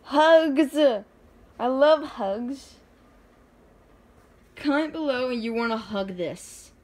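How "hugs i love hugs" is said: loud, high gushing over hugs; excited squeal. Real, not acted. Gushing to viewers about a cute character: loud, delighted. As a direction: burst out with delight, then slow down.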